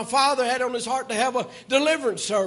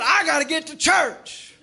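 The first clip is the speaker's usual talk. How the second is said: desperate plea; 7 semitones higher than usual. Retold or imitated. Frightened son rushing to church, retold panicked cry. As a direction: plead like a scared boy running to church.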